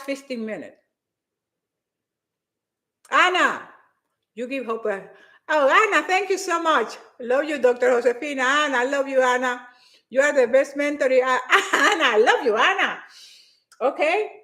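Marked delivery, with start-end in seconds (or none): none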